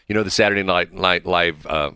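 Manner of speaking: usual speaking voice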